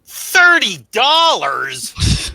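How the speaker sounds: thirty dollars drawn out slow, high and loud; slow, pointed emphasis on the price; stressed price. Real, not acted. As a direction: lean hard on thirty dollars in disbelief. Sarcastic jab at the book's price.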